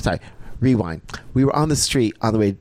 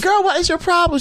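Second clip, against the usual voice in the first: exasperated high question; 4 dB louder than usual. Retold or imitated. Confronting stunned friend.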